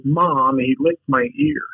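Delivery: mock whiny complaint, pitched high on ear; tattling to mom in a high whine; whiny brother. Brother tattles to mom about the lick, in a high mock whine. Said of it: "And he goes".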